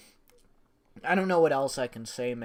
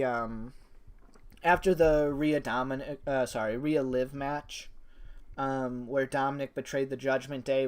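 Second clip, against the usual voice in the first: normal talking voice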